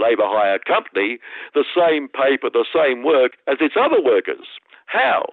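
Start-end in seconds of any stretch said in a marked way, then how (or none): 4.9-5.3 mock outrage.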